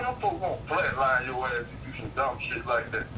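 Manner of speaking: usual speaking voice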